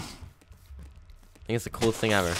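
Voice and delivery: normal talking voice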